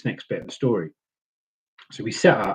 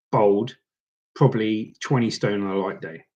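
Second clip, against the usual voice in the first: usual speaking voice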